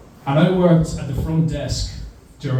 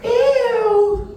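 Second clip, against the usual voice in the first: put-on disgusted kid ew. Kids' disgusted cry.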